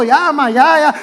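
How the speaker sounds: loud mock pleading to mom; exasperated kid cutting mom off, played for laughs; fed-up child. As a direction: imitate kids begging mom to stop. Children fed up with mother's repeating.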